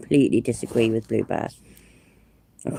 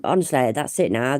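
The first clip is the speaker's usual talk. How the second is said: normal talking voice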